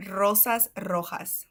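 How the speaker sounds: slow "rosas rojas", trilled r's; rolled R. Shown as a demonstration. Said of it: "roll the R".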